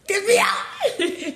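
excited shout. Real, not acted. Thrilled retelling meeting her idol.

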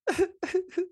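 sudden high-pitched giggle; high squeaky laugh. Real, not acted. Laughs at being teased.

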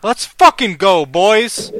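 loud, high stretched-out cheer. Real, not acted. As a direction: yell it for the team. Cheering the game.

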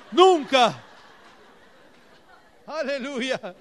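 a shout of victory from the pulpit; triumphant shout. Real, not acted. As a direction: burst out with it. Exclaims to the congregation.

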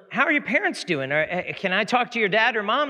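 put-on high, chirpy salesman greeting a kid; salesman on the phone. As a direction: pitch it up to greet a small child. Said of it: "there's a salesman on there".

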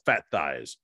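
low, deadpan machine voice; imitated voice-box output; electronic voice box. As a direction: drop low like a talking gadget. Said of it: "he heard it go".

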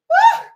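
high, loud whoop; preacher's whoop. Real, not acted. Preacher whooping at the congregation. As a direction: let out a joyful woo.

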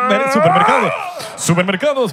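sudden high shout of the store name; mock shout. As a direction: belt it out like a joke.

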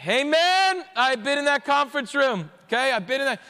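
loud, high church amen; a leader agreeing from the pews; emphatic mock congregation reply; fervent amen. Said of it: "every leader in this room is saying".

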